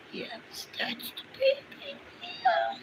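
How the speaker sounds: tiny soft squeak of affection. Real, not acted. Telling the pet it's the baby, soft high baby talk. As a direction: coo very high and quietly.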